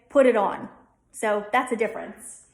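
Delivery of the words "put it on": "Put it on." with a Delco aw and schwa in "on"; Delco accent. Shown as a demonstration. Teacher contrasts the Delco line in a Delco accent. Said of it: "here we'll say".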